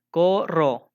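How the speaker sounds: Corro with the first O held long; stress on CO. Shown as a demonstration. Teacher showing the rhyme starts at O in Corro, long held CO. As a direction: stretch CO in Corro, then a separate rro. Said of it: "de la O por allá".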